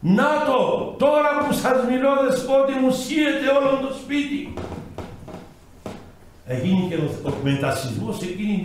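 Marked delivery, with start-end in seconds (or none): 0.1-4.5 retold shout. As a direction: call out in alarm as the house shakes. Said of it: "αρχίζει ο άνθρωπος να φωνάζει".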